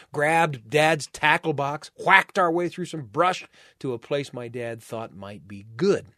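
plain reading voice

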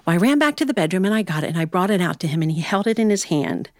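ordinary delivery

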